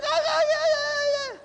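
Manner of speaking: very high, loud, frantic yeahs. Retold or imitated. Mimicking the group's first frantic attempt, with high loud gasps. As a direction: gasp it out like you're scrambling to keep up. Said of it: "First time, it's just like".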